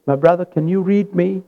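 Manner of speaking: ordinary talk, nothing marked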